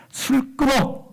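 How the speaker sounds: high-pitched mock scolding command. Priest Eli rebuking praying Hannah he thinks drunk, scolding. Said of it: "이렇게 야단을 칩니다".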